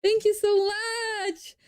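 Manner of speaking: high, drawn-out squeal of gratitude; squeaky, delighted thank-you; gushing thanks. Real, not acted. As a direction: gush sweetly in a very high voice. Streamer gushes thanks to a viewer.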